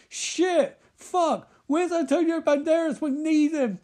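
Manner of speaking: high, frantic yelp; a kid desperately calling for help; mock panic. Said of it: "Spy Kid's sort of like".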